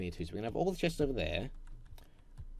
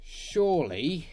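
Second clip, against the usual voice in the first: drawn-out doubt; 5 semitones higher, 7 dB louder, slower than usual. Real, not acted. Hopeful at path.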